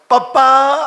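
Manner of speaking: loud calling cry of Papa; shout. Pretend. Dying man calling loudly to his father. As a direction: cry out to father at the last moment. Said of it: "હું તો બૂમ પાડીશ".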